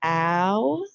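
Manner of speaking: Ow said alone as a spelling sound. Shown as a demonstration. Teacher sounds out the first syllable. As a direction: sound out Ow clearly. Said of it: "Say the sounds again".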